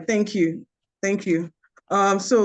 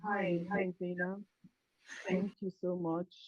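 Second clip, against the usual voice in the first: very faint, distant voice; weak, far-off speech; barely audible; 16 dB quieter than usual. Real, not acted. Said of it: "Can you be a little bit louder?".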